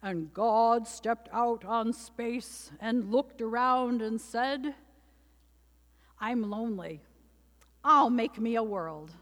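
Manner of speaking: slow, booming voice of God; deep voice. Acted. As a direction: read it like a deep, grand preacher. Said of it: "I should say it in a deep voice".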